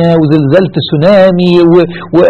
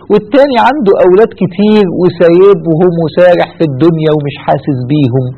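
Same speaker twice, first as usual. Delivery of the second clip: ordinary delivery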